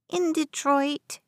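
put-on little boy. A narrator's reading. West answers the old woman's question, high slow childish voice. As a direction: answer as a small boy, drawn out.

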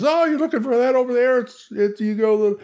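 odd dungeon stranger. Acted. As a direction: blurt out directions like a strange wanderer. Mystery character in dungeon gives directions.

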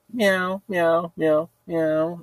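small, high meows in reply; high-pitched mock meowing; cat meowing. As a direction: imitate a cat answering back. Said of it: "the cat comes meowing to me".

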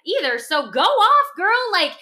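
high, fast, gushing cheer; cheering supporter. Retold or imitated. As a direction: hype her up like a proud friend. Voicing a supportive cheering fan.

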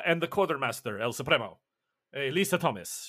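usual speaking voice

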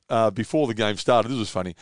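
ordinary delivery

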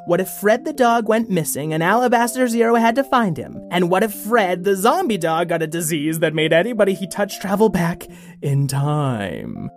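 ordinary delivery

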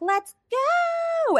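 put-on very high kid's call; bratty child calling ahead; whiny kid shout. Ellie voiced as kid.

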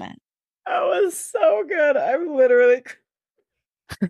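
high, drawn-out helpless laughter; real laughing-crying, words stretched; sobbing with laughter. Real, not acted. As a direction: gasp it through real tears of laughter. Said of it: "I'm sobbing".

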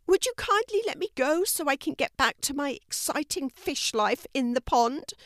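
high-pitched, polite little fish; fish character. Acted. Narrator voices the fish.

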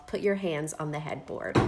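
low, soft growled command; growled warning. Acted. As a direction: growl the order low and quiet. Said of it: "He growled".

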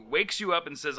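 usual speaking voice

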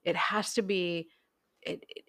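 usual speaking voice

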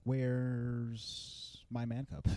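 very slow, soft demand; menacing villain. Retold or imitated. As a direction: draw out the question with quiet menace. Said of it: "He comes back to the wolf pack".

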